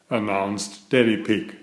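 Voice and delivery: plain reading voice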